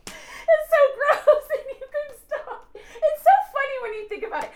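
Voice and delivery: laughing hard, slowed, high and loud. Real, not acted. Telling the host about the foot tickling, laughing hard. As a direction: crack up helplessly at the memory.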